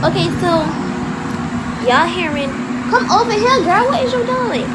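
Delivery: loud high call to a dog. Real, not acted. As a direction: call the dog over across the room. Calling her dog Journey over.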